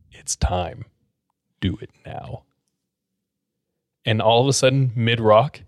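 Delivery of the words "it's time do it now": low, soft, ominous second voice; dark, deeper voice. Retold or imitated. Patient answering himself in a dark, deeper voice. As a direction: drop it low and quiet, darkly. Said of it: "in a dark, deeper voice".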